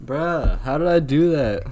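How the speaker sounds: loud, disbelieving outburst; frustrated shout. Real, not acted. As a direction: blurt it out in frustration. Dies, frustrated.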